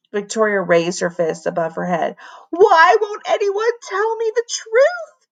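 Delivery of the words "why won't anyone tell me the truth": high, slow anguished shriek; furious wail, pitched high. A narrator's reading. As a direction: shriek it in frustration. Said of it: "she shrieked".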